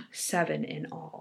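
hushed whisper of the count. A narrator's reading. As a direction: drop to a whisper. Narrator whispers gruesome count.

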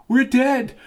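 high, panicked cry of despair; terrified cry. Acted. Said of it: "cried Rita".

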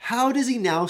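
high, loud indignant challenge; grumbling crowd. Acted. Grumblers questioning Jesus' claim, in loud high indignation. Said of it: "Listen, they grumble".

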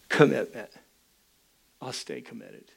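low murmur fading very soft; sober resolve. Retold or imitated. Husband looking at his ring alone, quiet resolve. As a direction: think it aloud, low, dropping almost to nothing.

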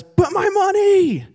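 high, loud, drawn-out yell; protesting wail. Acted. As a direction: wail it like a drowning man who won't let go. Drowning man protests to rescuer.

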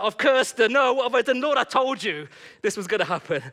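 loud, high, hammed-up alarm; mock panic. Man fearing his prayer backfired, mock panic. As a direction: wail it to God in comic dismay.

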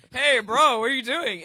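loud, sharp street call. Retold or imitated. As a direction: yell it across the sidewalk. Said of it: "They were like".

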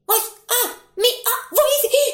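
frightened fisherman, voice shooting up; terrified pleading. Acted. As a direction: beg for your life. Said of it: "οικέτευσε".

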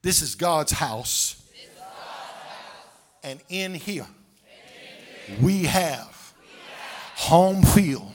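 loud, rising, chanted preaching; full-force preaching. Acted. Preacher rallying the congregation, loud chanted preaching. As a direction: build them up and shout it home.

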